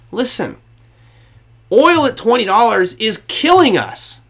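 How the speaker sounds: put-on slow high-pitched plea without the accent; acted plea, no accent. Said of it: "Should I do the Russian accent?".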